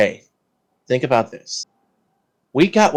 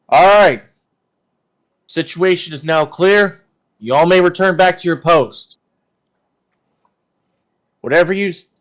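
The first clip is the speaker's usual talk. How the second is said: commanding officer; 6 semitones higher, 6 dB louder than usual. Acted. Player voices his character's orders.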